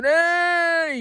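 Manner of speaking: high, loud call out; retold child shouting; kid's mock yell. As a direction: yell it like a boy. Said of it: "Dan's going".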